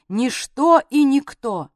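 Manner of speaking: plain reading voice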